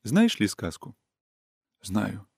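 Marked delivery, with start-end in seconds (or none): 1.8-2.3 much softer, almost a whisper on «Знаю». A narrator's reading. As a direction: breathe the word «Знаю» out quietly. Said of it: "прошептал Ордынов".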